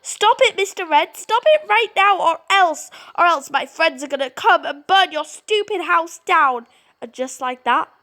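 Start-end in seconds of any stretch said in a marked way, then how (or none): 0.0-6.7 furious scream. Acted. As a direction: scream it at the dark to make it stop. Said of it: "Furious, you scream".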